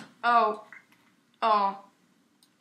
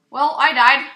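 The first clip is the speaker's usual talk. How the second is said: loud exasperated groan of a line; real annoyed yell; frustrated shout; 3 semitones higher, 12 dB louder than usual. Real, not acted. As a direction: blurt it out, fed up at dying. Frustrated outburst after dying in game.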